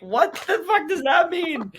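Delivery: high, slow, drawn-out yell; loud high-pitched mock outrage; incredulous shout. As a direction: shout in disbelief, stretching the words. Retold baffled comeback to angry dad.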